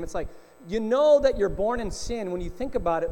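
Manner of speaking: ordinary delivery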